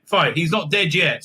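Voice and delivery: normal talking voice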